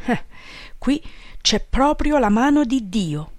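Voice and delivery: grave wonder, lifted at the end; serious exclamation. A narrator's reading. Boss marvels at a miracle before the priest, serious. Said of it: "esclamò serio Peppone".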